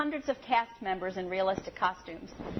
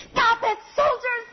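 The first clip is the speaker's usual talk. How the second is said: put-on high, loud, frantic child; hysterical child's scream; 12 semitones higher, 8 dB louder than usual. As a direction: scream at the soldiers like a small girl. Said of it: "she screamed".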